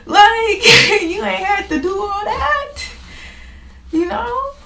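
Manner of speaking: high, loud, laughing whine; laughing protest. Retold or imitated. Speaker answering God's rebuke, high and laughing. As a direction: cry out half laughing. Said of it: "And I'm like".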